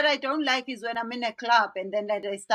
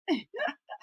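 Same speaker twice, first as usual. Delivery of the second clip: real laugh; 9 semitones higher than usual. Real, not acted. Interviewer laughing at the story.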